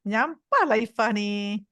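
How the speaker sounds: fond teasing through a smile; smiling. Shown as a demonstration. Aunt playfully tells niece she's fed up, smiling. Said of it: "saya senyum macam ni".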